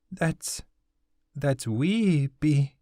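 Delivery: put-on high girl's voice, slow and faltering; a girl forcing out a painful memory; mournful girl's voice. Girl tells Hattie how his wife died, high and slow. Okizi breaks off in grief.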